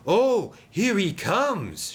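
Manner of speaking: high, drawn-out excited call; shouted. A narrator's reading. Sidney spots Den returning, excited shout. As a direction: call out the arrival, stretched and loud. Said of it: "shouted Sidney".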